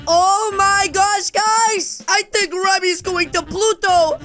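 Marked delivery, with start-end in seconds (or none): none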